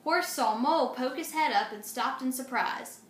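plain reading voice

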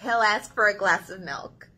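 lively picture book reading; reading to children. Acted. Librarian reading If You Give a Mouse a Cookie to children, expressive. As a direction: read it with big expressive turns for kids.

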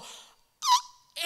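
tiny high-pitched mock shriek; army's scared scream. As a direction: squeal like a frightened army, comically thin. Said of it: "they all go".

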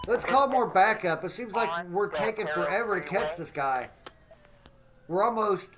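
ordinary talk, nothing marked

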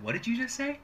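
high, baffled "What did you just say?"; a friend stunned by the word. Retold or imitated. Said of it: "My friend said".

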